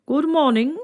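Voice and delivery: high, cheery chicken greeting; Mister Chicken's greeting. A narrator's reading. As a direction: chirp it out brightly as the bird. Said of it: "said Mister Chicken".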